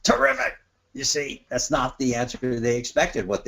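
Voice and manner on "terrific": forced enthusiasm. Retold or imitated. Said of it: "acted booth dialogue".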